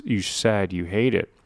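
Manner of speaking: ordinary talk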